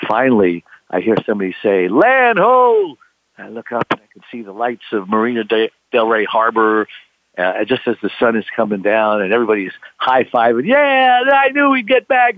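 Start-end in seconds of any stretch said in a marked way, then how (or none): 1.9-3.0 high, louder call. Retold or imitated. Crewman spotting the harbor, loud call. Someone calling out land. 10.7-12.3 high, louder bragging; smug cheer. Retold or imitated. Relieved crewman bragging at the harbor, high cheer.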